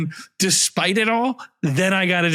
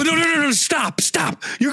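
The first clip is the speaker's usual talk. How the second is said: panicked shout; 8 semitones higher than usual. Acted. Coworker stops him eating buffet.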